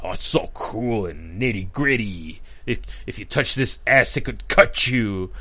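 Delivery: low, hushed mock-edgy voice; mock gritty. Mocks the modern gritty logo.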